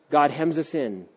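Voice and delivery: ordinary talk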